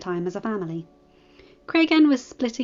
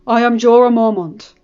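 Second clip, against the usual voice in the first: a hint of a hearty old commander, louder; lightly voiced lord commander; 2 semitones higher, 10 dB louder than usual. The Lord Commander apologizing and welcoming Robb, lightly voiced hearty host. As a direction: welcome them with gruff courtesy.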